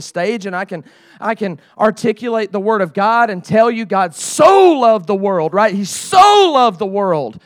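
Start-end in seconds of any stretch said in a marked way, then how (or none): 4.1-5.5 drawn-out, loud SO; stress on SO. Shown as a demonstration. Preacher telling youth leaders God loved the world, stress on SO. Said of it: "I can emphasize the word so". 5.7-7.4 SO louder and held long; stress on SO. Shown as a demonstration. Preacher repeating the verse to show emphasis, stress on SO. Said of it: "I can emphasize the word so".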